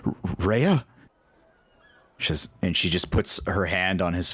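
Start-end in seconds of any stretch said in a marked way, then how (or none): 0.0-0.8 stammered, rising question in a quiver; hesitant, higher-pitched quiver. Acted. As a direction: catch on the first sound, then lift the name in quivering disbelief. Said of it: "hear this quiver in his voice".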